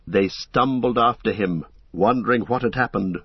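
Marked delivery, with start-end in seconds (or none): none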